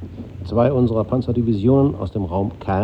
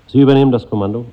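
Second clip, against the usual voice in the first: fast, louder order; brisk command; 3 semitones higher, 7 dB louder, faster than usual. Acted. Colonel handing command to his officer, brisk and firm. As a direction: snap out the order, decisive.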